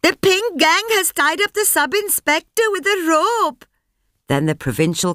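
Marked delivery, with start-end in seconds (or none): 0.0-3.6 high, excited crowd cry; crowd shouting. A narrator's reading. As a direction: cry it out to the street. Crowd shouting news outside.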